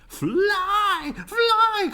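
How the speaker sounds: high, loud urgent call. A narrator's reading. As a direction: shout a warning to the bees. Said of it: "called the birds to the bees".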